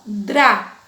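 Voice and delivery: Drá with a trilled, scratchy R; scratched R. Shown as a demonstration. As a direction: roll the R in Drá. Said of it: "a gente vai arranhar o som". (Drá com o R arranhado)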